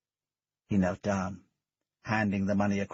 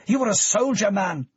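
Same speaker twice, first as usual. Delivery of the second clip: higher, louder angry challenge; 11 semitones higher, 7 dB louder than usual. Acted. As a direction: throw the question at him in anger. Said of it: "full of anger".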